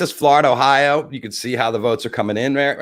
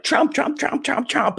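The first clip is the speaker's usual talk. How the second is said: high-pitched, rapid-fire parroting; mocking imitation; slower than usual. Imitating Trump supporters who scolded him, mockingly. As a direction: squawk the name over and over, pitched way up.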